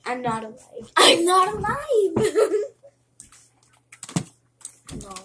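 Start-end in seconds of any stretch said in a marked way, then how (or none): 1.0-2.6 loud, high, drawn-out yell; shouting. Real, not acted. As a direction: yell it out, breaking into a laugh. Repeats it shouted in the game.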